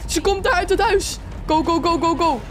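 high, urgent escape cries; panicked urging. Real, not acted. Player fleeing someone coming out of the house, panicked urging. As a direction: squeal in alarm while fleeing.

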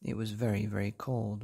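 usual speaking voice

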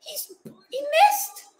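high, breaking gasp of disbelief; stunned cry. Real, not acted. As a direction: let it catch in the throat, high and shaken. Reacting to the missed penalty.

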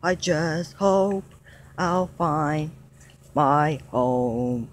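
slow, low, drawn-out longing; sad lament. Acted. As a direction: stretch each word like a lonely wish. Dino's lonely lament, long held.

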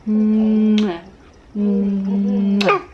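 drawn-out smacking kisses. Real, not acted. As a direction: mwah kiss sounds. Kiss sounds to baby.

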